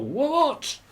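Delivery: high-pitched mock irritation; hammed-up fed-up question; exasperated what. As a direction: fling it out high at whoever interrupted. Acts snapping at interrupter.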